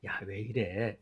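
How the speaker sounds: a gentle, unhurried low reproach; mild rebuke. Retold or imitated. Jesus quietly asking the frightened disciples, soft low rebuke. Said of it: "아니면 좀 언성을 높이셨을까?".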